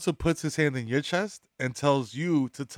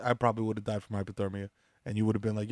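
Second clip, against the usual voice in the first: ordinary delivery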